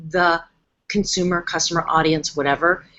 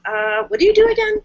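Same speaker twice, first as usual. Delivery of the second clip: a hint of a confused customer; baffled question; 13 semitones higher than usual. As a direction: question pitched much higher, mock bewildered. Confused site visitor asks.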